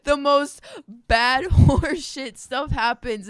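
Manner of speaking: usual speaking voice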